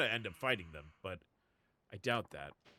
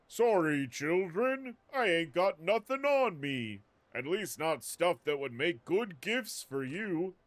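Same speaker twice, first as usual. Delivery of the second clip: higher, slower gruff Santa voice; Santa Claus voice; 4 semitones higher, 5 dB louder, slower than usual. Retold or imitated. Streamer imagines Saejima as Santa telling kids, hammed-up Santa voice. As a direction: play a put-upon Santa letting kids down. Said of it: "like, a Santa Claus voice?".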